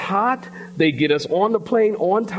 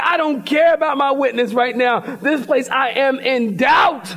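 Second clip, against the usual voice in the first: exasperated outburst; 6 semitones higher than usual. Retold or imitated. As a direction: throw your frustration out like you did on the plane. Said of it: "I was like".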